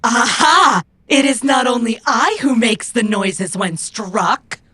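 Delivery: loud gloating shout; triumphant shout. Acted. Robot gloating after landing a punch, triumphant shout. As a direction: crow over the hit.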